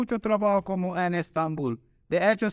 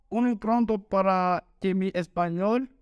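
ordinary talk

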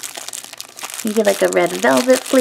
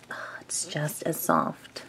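whispering; 6 semitones lower, 14 dB quieter, slower than usual. Real, not acted. Squishy review, whispering close.

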